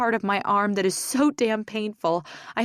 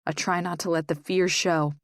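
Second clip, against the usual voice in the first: ordinary delivery, nothing marked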